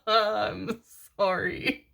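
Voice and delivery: high laugh sliding into a drawn-out sorry; laughing apology. Retold or imitated. Reader retelling her apology to scolding ancestors, laughing. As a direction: apologise through laughter, stretching it out.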